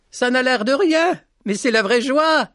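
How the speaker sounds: put-on high boyish voice calling out gladly; a young boy calling with joy; calling out laughing. A narrator's reading. Petit Jean calls laughing to the lady at the henhouse, high and glad. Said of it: "Il lui cria en riant".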